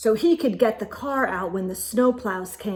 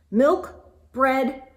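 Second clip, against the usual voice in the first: usual speaking voice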